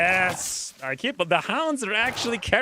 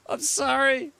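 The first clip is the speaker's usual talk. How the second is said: normal talking voice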